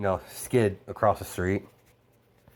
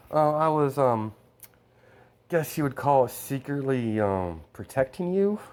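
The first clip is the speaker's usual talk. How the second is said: higher, hesitant sheepish voice; sheepish admission; 6 semitones higher, 3 dB louder than usual. Acted. Boy admitting to the girl he protected her, sheepish higher voice. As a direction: own up awkwardly, pitched up.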